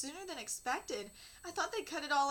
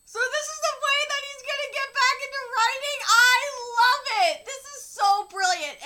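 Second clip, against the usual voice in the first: much higher and much louder, bursting with delight; thrilled gushing; 9 semitones higher, 17 dB louder than usual. Real, not acted. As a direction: rave about it like your favourite show. Reader gushes over the plot twist.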